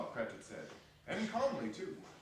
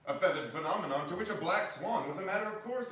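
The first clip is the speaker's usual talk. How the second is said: higher and louder, bubbling with excitement; building excitement over the goose; excited narration; 7 semitones higher, 6 dB louder than usual. A narrator's reading. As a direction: tell it like the whole house is in a flurry.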